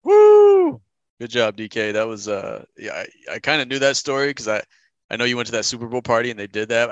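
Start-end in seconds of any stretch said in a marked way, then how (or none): 0.0-0.8 loud, high, real celebratory whoop; cheering whoop. Real, not acted. Co-host cheers the comedian's finished bit, loud whoop. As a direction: whoop loud to applaud him.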